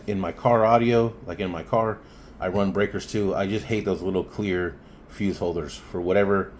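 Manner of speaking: ordinary delivery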